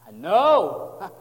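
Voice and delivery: loud, swelling sympathetic groan of agreement; sighing Jesus. Acted. Preacher voices Jesus answering those who question evil, sighing. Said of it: "just imagine Jesus just sighing".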